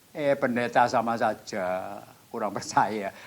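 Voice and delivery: slow, drawn-out taunt; mengejek diri. Retold or imitated. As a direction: stretch out the mocking reproach. Menegur diri dengan nada mengejek. (ejekan pelan yang dipanjangkan)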